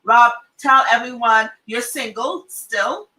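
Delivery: usual speaking voice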